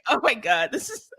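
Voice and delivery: laughing imitation. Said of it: "in Gilles' voice".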